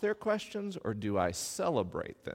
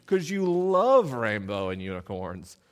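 tender parent; 3 semitones lower, slower than usual. Retold or imitated. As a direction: coo it gently to a toddler. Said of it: "My approach was like".

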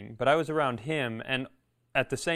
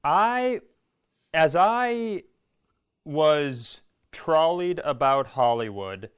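put-on voice; 3 semitones higher, 4 dB louder, slower than usual. Comic posh storytelling voice.

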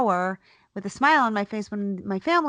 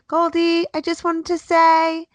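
much higher, sweet mom voice; overly sweet goodbye to the toddler; sugary sing-song; 8 semitones higher, 3 dB louder than usual. Retold or imitated. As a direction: lure her in with honeyed sweetness. Sugary bluff to daughter.

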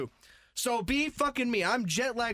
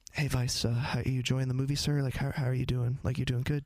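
low-pitched, hesitant server small talk; chatty cinema waiter; 9 semitones lower than usual. Acted. Waiter checking on a viewer during the movie, chatty low voice. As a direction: play an intrusive waiter murmuring low. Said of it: "it's different where they're like".

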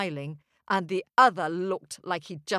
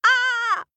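巨人的怒吼; giant's roaring shout; 8 dB louder than usual. Acted. Giant's roar.

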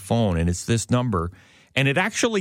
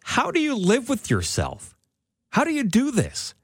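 indignant reproach; 12 semitones higher than usual. Retold or imitated. Teller retelling how she scolded the telemarketer, high indignant reproach. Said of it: "And I said".

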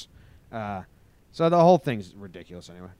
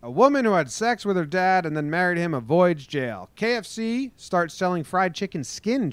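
higher-pitched newscaster delivery; news headline read; 7 semitones higher, 4 dB louder than usual. Acted.

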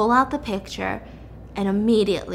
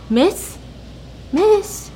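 calling to rouse someone; 10 semitones higher than usual. Acted. Old lady rousing a sleeper.

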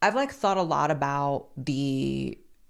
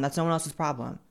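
ordinary delivery

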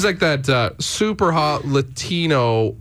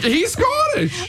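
mock-indignant protest; much slower than usual. Real, not acted. Defends his Shrek impression.

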